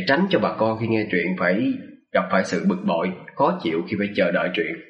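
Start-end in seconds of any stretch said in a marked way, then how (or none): none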